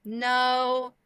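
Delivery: high, drawn-out no; awkward denial. Retold or imitated. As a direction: deny it unconvincingly, stretching the word. Denies being recognized in sex store.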